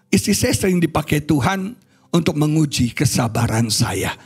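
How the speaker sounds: ordinary talk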